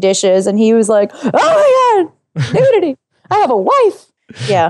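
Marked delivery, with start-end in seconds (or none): 1.1-4.1 offended. Retold or imitated. Castaway mimicking an offended tribemate caught by nudity, mock offended. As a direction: imitate a scandalized man, voice pitched way up. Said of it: "so offended when he came down".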